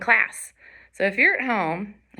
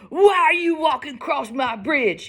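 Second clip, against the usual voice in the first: raised, higher yelling voice; troll's yell; 6 semitones higher, 4 dB louder than usual. Acted. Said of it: "yelled the hideous".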